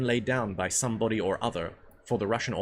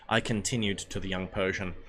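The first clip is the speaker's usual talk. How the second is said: plain reading voice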